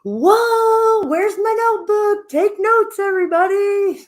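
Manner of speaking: high, loud, excited squeal; mock excited note-taker. Guest retelling her own reaction, high and excited. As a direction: squeal it like an eager student grabbing a notebook. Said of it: "I was sitting here going".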